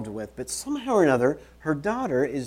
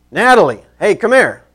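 loud, higher shout across the house; calling out; 8 semitones higher, 12 dB louder than usual. Pretend. Preacher demonstrates shouting for someone at home, calling out. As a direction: holler for someone far away. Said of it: "I'll be like".

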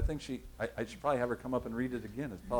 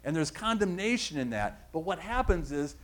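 ordinary talk

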